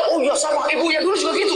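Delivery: husband quoted in a high mocking voice; the preacher mimics the husband who joins the neighbour gossip, in a high mock voice. A mocking husband who sneers at the neighbour, voiced high. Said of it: "Apa kata suaminya?".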